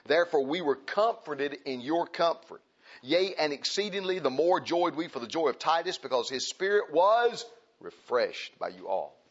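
a touch of a solemn Bible reading voice; scripture reading. Acted. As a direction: read the verse out to the congregation. Reads Scripture aloud.

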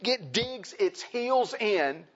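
drawn out very slowly. Acted. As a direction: stretch each word to show the stubborn flesh. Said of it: "you know what the flesh does?".

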